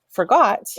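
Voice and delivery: Forgot, leaning hard into the got; quick for, then a lifted GOT in Forgot; stress on second syllable. Shown as a demonstration. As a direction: say Forgot with a longer, stronger got. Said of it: "You lean into that word just a little bit more".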